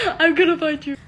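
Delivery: real laugh through a high, loud threat. Real, not acted. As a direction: threaten them while cracking up. Repeats own threat while laughing.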